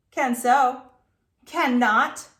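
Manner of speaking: shouting back and forth; loud bickering. A narrator's reading. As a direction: bicker loudly like kids. Children arguing over a bet.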